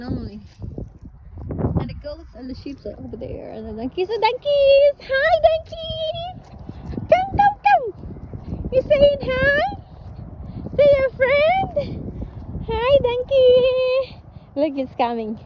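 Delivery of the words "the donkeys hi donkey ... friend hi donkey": high, loud, drawn-out singsong call; calling out to animals. Real, not acted. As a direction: call the donkeys over from afar, excitedly. Calling out to the donkeys.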